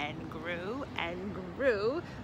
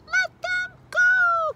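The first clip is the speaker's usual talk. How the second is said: very high, slow, loud shriek; young frogs crying out; 12 dB louder, much slower than usual. A narrator's reading. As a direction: shriek it out as a crowd of little frogs. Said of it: "cried nine hundred and ninety-nine young frogs".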